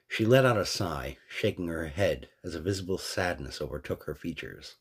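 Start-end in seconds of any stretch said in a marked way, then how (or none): none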